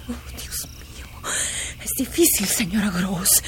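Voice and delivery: breathless, hushed whisper; ashamed whisper. Acted. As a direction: barely get the words out. Confessing something shameful.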